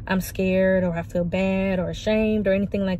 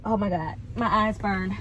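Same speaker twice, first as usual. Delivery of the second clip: usual speaking voice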